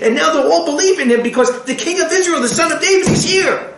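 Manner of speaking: high, eager rush; excited believers. Retold or imitated. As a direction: bubble over with good news. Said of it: "they're gonna be so excited".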